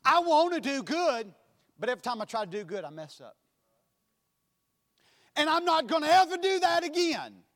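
earnest churchgoer's confession, rising at the end. Acted. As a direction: plead as a struggling believer. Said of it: "this is their life".

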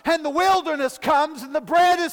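fervent sermon climax; preaching at full force. Acted. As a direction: build the congregation up to a shout.

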